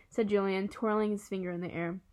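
plain reading voice

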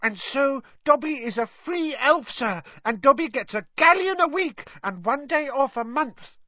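high squeaky elf voice, proud and eager; excitable Dobby elf. Acted. Dobby tells of his pay from Dumbledore, high and eager. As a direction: chirp it out like an eager little house elf.

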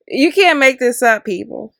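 usual speaking voice

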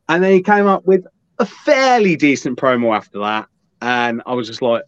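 ordinary talk, nothing marked

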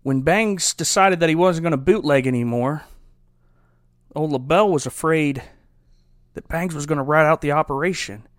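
ordinary talk, nothing marked

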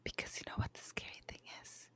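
creepy teasing whisper. Acted. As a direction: whisper it like a threat. Spooky whisper teasing co-host.

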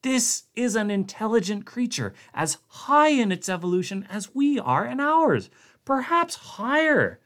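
high, pleading rush; frantic scientist. A narrator's reading. Blair urgently explaining to Connacht, frantic and high. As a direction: explain desperately before they act.

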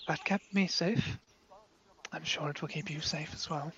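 soft, choked-up farewell; a father quietly fighting back tears; tearful father. Acted. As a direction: hold back tears as you speak. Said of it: "father's eyes begin welling up with tears".

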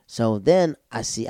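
ordinary delivery

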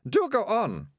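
put-on high, triumphant girl egging them on. A narrator's reading. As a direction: urge the thug on in triumph. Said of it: "said Melicia triumphantly".

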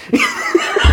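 airy high giggle; high laugh. Real, not acted. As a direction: let out a breathy laugh. Laughing at friend's mistake.

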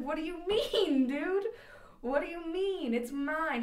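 high, loud mock outrage; indignant protest. Student protesting to teacher over the free drinks, mock indignant.

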